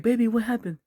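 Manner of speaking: high falsetto, concerned wife; wife's voice. Acted. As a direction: ask it in a high put-on wife voice. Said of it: "His wife's like".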